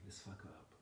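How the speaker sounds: low-volume secret aside; conspiratorial whisper. Retold or imitated. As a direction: imitate a cousin whispering a plan.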